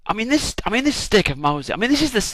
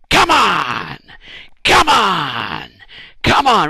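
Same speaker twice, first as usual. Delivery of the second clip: loud, high rallying yell; cheering shout; 4 semitones higher, 8 dB louder, much slower than usual. Retold or imitated. Moses on the hill shouting encouragement to his army, loud cheering yell. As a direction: roar the troops on from the hilltop. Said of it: "Really kind of cheering them on".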